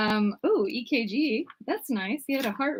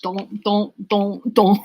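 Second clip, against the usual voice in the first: chanting don't as a robotic beat; beatboxed don't, don't, don't, don't; mock techno beat; 5 dB louder, slower than usual. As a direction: echo a retro techno loop with your voice. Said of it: "that was a really retro techno beat".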